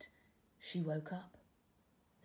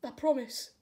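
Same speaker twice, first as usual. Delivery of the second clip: tadpole promising; 7 semitones higher, 7 dB louder than usual. Acted.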